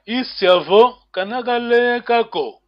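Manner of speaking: "Isiavo kanakalekako" high and loud, falling at the very end; modelled question tone. Shown as a demonstration. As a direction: demonstrate "Isiavo kanakalekako" emphatically, lifting "Isiavo". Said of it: "you miss your tone".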